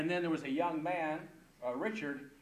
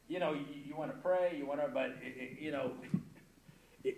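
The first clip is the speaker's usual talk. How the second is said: ordinary talk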